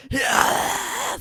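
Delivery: high hoarse unvoiced shout; raspy drill instructor yell. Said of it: "the fucking raspiest voices ever".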